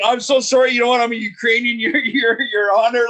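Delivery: high, loud, laughing apology; realizing he insulted the mayor, laughing apology; flustered laughing apology. Retold or imitated. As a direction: gush an embarrassed sorry through laughter.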